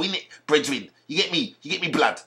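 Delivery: imitated London rioters' slang. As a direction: mimic rioters' London street talk. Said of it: "That's how they talk though".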